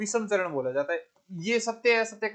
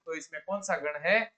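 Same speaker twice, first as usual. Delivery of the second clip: ordinary talk, nothing marked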